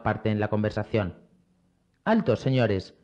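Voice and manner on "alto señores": ordinary delivery, nothing marked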